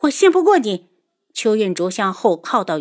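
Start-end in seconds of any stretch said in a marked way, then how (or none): 0.0-0.9 high, loud rebuff; defiant distrust. A narrator's reading. Daughter to her father, defiantly distrustful. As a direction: reject his word outright.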